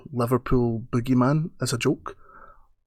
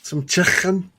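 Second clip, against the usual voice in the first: hammed-up Scouse comeback, pitched up; put-on Scouse accent; 6 semitones higher than usual. Streamer firing back at an abusive chatter, hammed-up Scouse accent. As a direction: do a mock Liverpool accent with a throaty "chicken". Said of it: "doing an accent might not be your humour".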